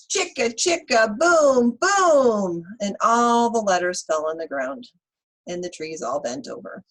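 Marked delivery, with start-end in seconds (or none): 0.1-4.9 loud chanted refrain; reading to children. Acted. Teacher reading to the class, chanting the refrain loudly. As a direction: belt out the chant for the kids. Reading picture book aloud.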